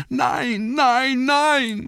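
very high, terrified cries; panisches Schreien. A narrator's reading. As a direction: cry out in panic after collapsing. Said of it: "schrie er". (in Panik schrill aufschreien)

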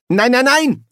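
high, loud string of noes; exasperated protest. Acted. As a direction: cut in, fed up. Schnapper despairing at the same old scene.

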